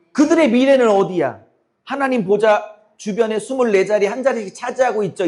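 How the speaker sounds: usual speaking voice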